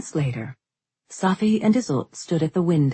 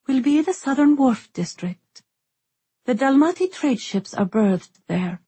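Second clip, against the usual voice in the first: put-on young woman voice; 7 semitones higher than usual. A narrator's reading. Isolde voiced in narration.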